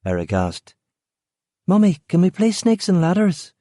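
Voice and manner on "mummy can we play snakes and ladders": put-on high little girl asking; a small girl pleading sweetly; child voice. A narrator's reading. Claire asking her mother to play, high child voice. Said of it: "Claire asked".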